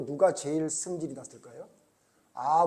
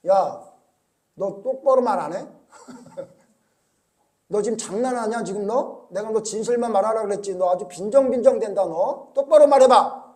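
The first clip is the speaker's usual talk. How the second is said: louder, higher angry scolding; scolding king; 4 semitones higher, 7 dB louder than usual. Retold or imitated. King Ahab angrily scolding the prophet. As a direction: tell him off, fed up.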